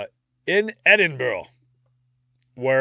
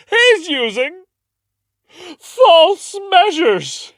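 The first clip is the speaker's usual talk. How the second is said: much higher, louder, slow theatrical cry of "false measures"; dramatic accuser; 10 dB louder, slower than usual. Retold or imitated. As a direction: ham up the courtroom accusation. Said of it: "She goes".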